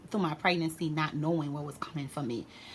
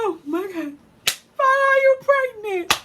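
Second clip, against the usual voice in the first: very high, loud tearful lament; mock wailing; 7 dB louder than usual. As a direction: imitate a distraught mother bawling. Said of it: "She crying".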